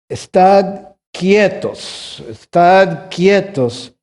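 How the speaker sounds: very slow, stretched scripture line; slow solemn command. Acted. Pastor reading God's command, slow and solemn. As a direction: let each word hang.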